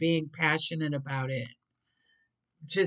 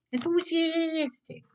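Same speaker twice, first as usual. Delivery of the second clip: much higher, comic squeak; squeaky silly voice; 12 semitones higher than usual. Pretend. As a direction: toss the word up in a silly squeak. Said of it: "stuck on that word".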